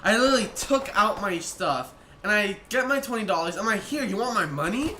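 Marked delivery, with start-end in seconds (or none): 3.8-5.0 higher, showy challenge with a rising end; hammed-up retort; exaggerated challenge. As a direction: overplay the offer of money. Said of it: "I didn't say it like that".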